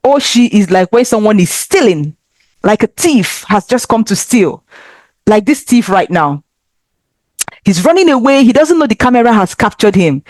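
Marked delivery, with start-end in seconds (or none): none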